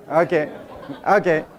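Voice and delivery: ordinary talk, nothing marked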